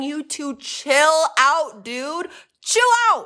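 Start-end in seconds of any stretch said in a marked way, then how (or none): 2.6-3.2 high-pitched burst of exasperation; exasperated outburst. Pretend. Host telling off an absent James, exasperated outburst.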